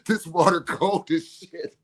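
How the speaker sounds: giggling helplessly; cracking up as he talks; laughing. Real, not acted. Laughing about cold water.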